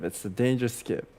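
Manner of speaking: ordinary talk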